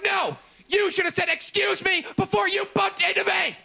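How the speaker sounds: high-pitched mock outrage; indignant scolding. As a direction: imitate an affronted lady telling someone off, high. Lady scolds him in retold quarrel.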